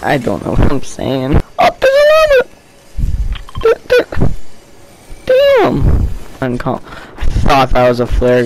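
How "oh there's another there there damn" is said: very high, squeaky outburst; high-pitched excited exclaiming; excited surprise. Real, not acted. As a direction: cry out at a lucky find. Spots what seems another flare gun.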